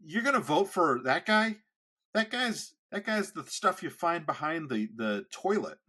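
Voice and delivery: high, loud, incredulous outburst; mock the godfather's shocked outrage; godfather's outraged rant. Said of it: "my godfather goes".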